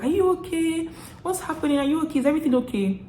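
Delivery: high-pitched imitation of worried colleagues; concerned labmates. Labmates checking on someone in tears, high mock concern.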